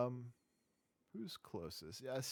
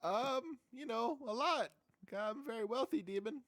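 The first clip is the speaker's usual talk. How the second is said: high, wheedling little demon bargaining; squeaky imp trying to bribe; whiny imp voice; 10 semitones higher, 7 dB louder than usual. Acted.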